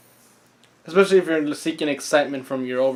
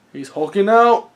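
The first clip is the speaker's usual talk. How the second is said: loud, excited exclamation; 5 semitones higher, 8 dB louder than usual. Real, not acted. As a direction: call it out thrilled. Excited at a transformation.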